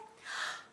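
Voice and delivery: short acted intake of breath; shocked gasp. Mummy bear sees her room, shocked gasp.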